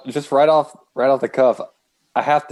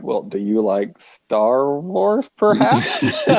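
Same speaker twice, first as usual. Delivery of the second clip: drawn-out question; 3 semitones higher, much slower than usual. Retold or imitated. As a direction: stretch it out, teasing.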